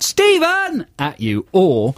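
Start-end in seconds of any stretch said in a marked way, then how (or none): 0.0-0.8 high drawn-out call of a catchphrase; shouted catchphrase. Shown as a demonstration. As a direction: call out the name high, as if across a public space. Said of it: "for Gavin to shout".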